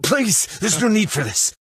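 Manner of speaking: high, urgent plea; desperate pleading. Acted. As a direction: beg through a locked door. Pleading through a locked door.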